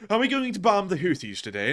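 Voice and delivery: high-pitched posh Eton schoolboy; posh child accent. Retold or imitated. Eton child asking on leaving class, high posh British accent. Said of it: "that is an incredible accent".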